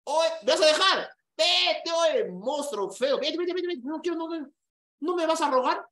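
high, loud, shooing the ex away; hammed-up yelling at the ex to go; mock defiant shout. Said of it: "Yo le dije". (gritos exagerados echando al ex)

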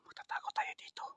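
unvoiced urgent whisper; whispering while hiding. Real, not acted. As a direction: whisper the plan to hide. Hiding from man with shovel.

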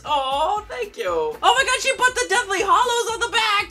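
ordinary talk, nothing marked

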